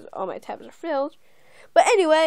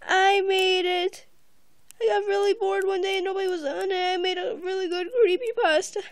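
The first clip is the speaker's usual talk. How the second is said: excited rush; 6 semitones higher, 4 dB louder, faster than usual. Real, not acted. Excited fast self-boast.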